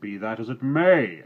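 loud gruff farmer's retort; angry farmer shouting up from the yard; shouted. Acted. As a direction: bark it back across the yard. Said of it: "shouted another voice".

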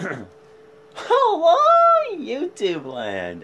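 bright, high drawn-out hello; excited greeting. Real, not acted. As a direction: greet the viewers with a big sing-song hello. Vlog opening greeting.

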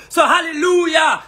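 loud, high burst of praise; exclaiming hallelujah. Real, not acted. Proudly calling out to the listeners, high and loud. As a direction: throw up a proud cheer.

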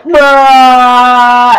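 one word held out loudly, level pitch; long loud bruh. Retold or imitated. Kid replying to the pizza guy, long loud bruh. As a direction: drag it out as a loud mock comeback. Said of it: "So he was like".

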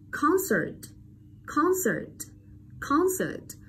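Concert high on con-, dropping to a short -cert; short unstressed schwa. Shown as a demonstration. Teacher drills weak vowel, concert with short falling -cert. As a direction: model "concert", first syllable high, second brief. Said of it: "vậy nó là âm ơ ngắn".

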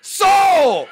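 very loud, high shout; imitating dad's yell. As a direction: bellow a mock order to hush. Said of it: "So era cállese". (grito imitado para mandar callar)

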